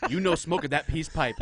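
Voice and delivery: hint of old-western caricature.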